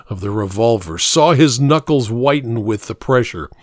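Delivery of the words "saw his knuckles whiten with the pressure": plain reading voice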